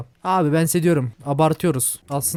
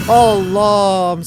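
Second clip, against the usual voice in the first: scared cry; 4 semitones higher, 9 dB louder than usual. Real, not acted. Scared by game monster.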